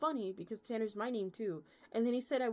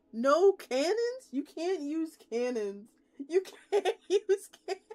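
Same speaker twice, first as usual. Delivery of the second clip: higher, louder and slow, cracking up; laughing disbelief; 8 semitones higher, 9 dB louder, slower than usual. Real, not acted. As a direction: drag it out in amused outrage. Reading absurd rule, laughing.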